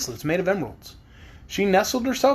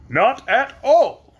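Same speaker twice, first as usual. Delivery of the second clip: metallic grateful tin man; polite Tin Woodman; 8 semitones higher, 6 dB louder, much slower than usual. Acted. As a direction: speak as a kindly tin man.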